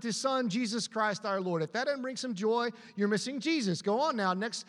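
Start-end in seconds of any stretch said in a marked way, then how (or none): none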